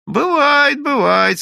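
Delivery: loud, high, drawn-in exclamation; worked-up knowing agreement; emphatic agreement. A narrator's reading. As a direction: jump in high and loud with conviction. Character butts in with knowing assertion.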